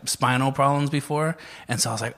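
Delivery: ordinary talk, nothing marked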